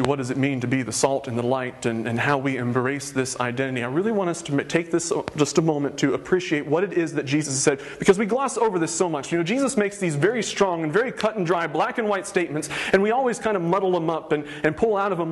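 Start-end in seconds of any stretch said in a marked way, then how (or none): none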